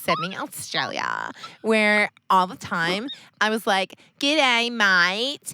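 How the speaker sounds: slow, exaggerated fake Australian; drawn-out mock Australian greeting; terrible Australian accent. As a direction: lay the bad Australian accent on thick. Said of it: "having a terrible accent".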